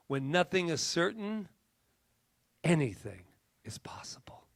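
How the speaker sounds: slow, weighty recitation with long pauses; solemn quote reading. Real, not acted. Speaker leaving listeners with a beautiful quote, slow dramatic recitation. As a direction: stretch each phrase, let endings sink to a hush. Reads a closing quote aloud.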